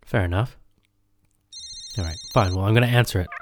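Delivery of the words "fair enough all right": normal talking voice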